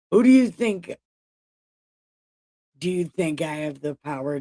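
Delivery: hammed-up outraged husband yelling back; mock furious Jacob rant; mock angry Jacob. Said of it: "Jacob's anger was aroused against Rachel and said".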